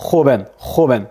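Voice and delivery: joven with a scraped kh at the start; guttural Spanish J. Shown as a demonstration. Teacher drilling the J, joven said kho-ben. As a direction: say joven as kho-ben, throaty first sound. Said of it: "quelque chose de plus guttural".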